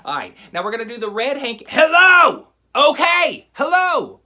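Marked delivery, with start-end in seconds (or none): 1.7-4.2 much louder, higher hammed-up exasperated yell; mock annoyed shout. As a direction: yell hello back at the kids, fed up. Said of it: "getting more and more annoyed".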